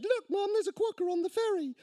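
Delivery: put-on high-pitched little boy voice; young son. A narrator's reading. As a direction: squeal it like an excited child to his mum. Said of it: "The son who had seen it said".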